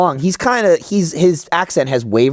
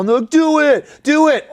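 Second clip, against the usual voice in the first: higher, insistent mock egging-on; imitated pestering; 7 semitones higher, slower than usual. Booth visitors demanding an accent, imitated pestering. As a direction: badger them in a raised pitch.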